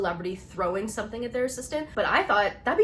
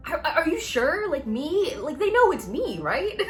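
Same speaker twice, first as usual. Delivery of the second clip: much higher, disbelieving questions; incredulous; 9 semitones higher, 4 dB louder than usual. Retold or imitated. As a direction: squeak out your doubt, laughing. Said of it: "I almost didn't believe her".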